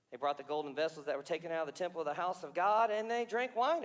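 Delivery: ordinary talk, nothing marked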